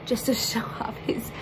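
unvoiced giggling whisper. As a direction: whisper through a laugh. Giggled whisper before reveal.

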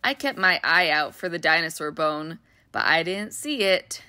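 ordinary delivery, nothing marked

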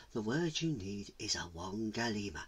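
low, very soft spirit voice; hushed, low-pitched mystical answer; mountain spirit. Acted. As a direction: reveal the secret word softly.

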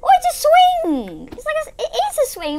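excited high-pitched rush; thrilled. Real, not acted. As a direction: gush about the swing. Thrilled discovery in game.

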